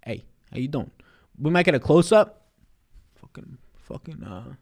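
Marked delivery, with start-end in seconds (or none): 3.2-4.5 whisper. Real, not acted. Muttering to himself mid-stream.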